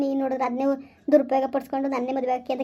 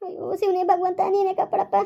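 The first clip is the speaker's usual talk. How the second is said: desperate prayer; 6 semitones higher than usual. Acted. Wife prays in despair.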